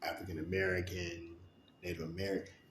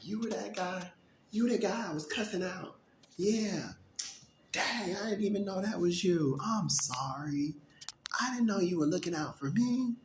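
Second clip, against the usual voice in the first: much higher put-on voice, louder, apologizing; high mock apology; 10 semitones higher, 5 dB louder than usual. Speaker mimics the man who cussed him, apologetic and high. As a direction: play the guy who cussed out his helper. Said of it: "laughing and cracking up 'cause I'm like".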